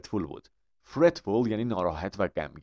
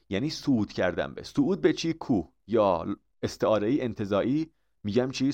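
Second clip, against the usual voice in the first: normal talking voice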